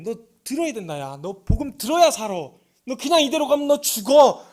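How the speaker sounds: desperate plea. Retold or imitated. Preacher imagining begging the boy to hear the gospel, loud and pleading. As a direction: beg him to stay with all you have.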